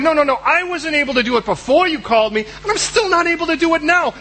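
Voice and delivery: high pleading complaint; a fretful excuse; whiny protest. Acted. Protesting to God.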